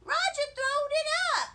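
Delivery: high, loud tattling holler. A narrator's reading. First grader tattling to the janitor, loud high holler. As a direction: yell it out, almost singsong. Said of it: "I hollered out".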